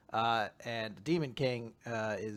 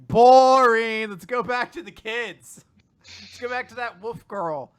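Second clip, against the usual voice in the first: high, loud jeer; mock bored heckle; 8 semitones higher, 14 dB louder than usual. Reacting to demon politics, high loud bored heckle. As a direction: call it out like an impatient heckler.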